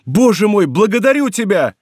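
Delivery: high, fervent thanks; exultant cry. A narrator's reading. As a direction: cry out to heaven in relief. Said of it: "вскричал молодой человек".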